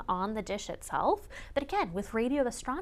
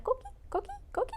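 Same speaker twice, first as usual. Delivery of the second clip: high chirpy two-note frog call; coqui frog call; 6 dB quieter, much faster than usual. Shown as a demonstration. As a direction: chirp it like a tiny tree frog. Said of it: "they made this, like".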